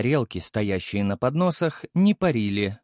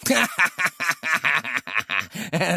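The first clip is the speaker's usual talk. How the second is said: sly snicker; 5 semitones higher than usual. A narrator's reading. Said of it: "ехидно ухмыльнулся".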